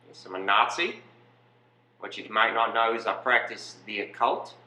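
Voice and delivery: matter-of-fact mock Australian accent; Australian Nazi. Nazi excusing himself as following orders, mock Australian accent. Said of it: "You're an Australian Nazi".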